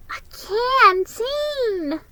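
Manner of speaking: a lightly voiced happy little boy; contented boy. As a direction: savour the gift. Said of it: "he said with contentedly".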